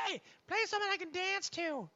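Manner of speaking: put-on high squeaky drunk girl.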